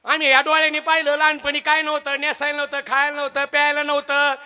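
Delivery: a lightly voiced wise old woman; a hint of an old village woman consoling. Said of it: "दुसरी म्हातारी काय म्हणते".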